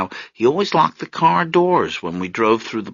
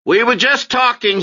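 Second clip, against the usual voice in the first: startled explaining; 13 semitones higher, 5 dB louder than usual. Acted. Marius caught by the father.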